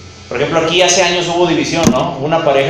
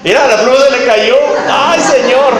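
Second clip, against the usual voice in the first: sudden higher, louder startled outburst; startled cry over a falling pen; real surprise; 6 semitones higher, 6 dB louder than usual. Real, not acted. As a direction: caught off guard by a dropped pen. Pen falls during sermon.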